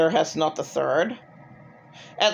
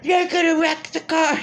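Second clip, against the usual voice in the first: high mock yell at the driver; imitated screaming; 10 semitones higher than usual. Imitating the man screaming at her while she drives, high mock yell. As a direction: mimic him yelling, pitched way up. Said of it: "while he's screaming in my face".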